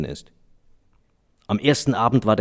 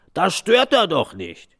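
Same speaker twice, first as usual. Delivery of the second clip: fat man; 8 semitones higher, slower than usual. Acted. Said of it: "sagte ein dicker Mann".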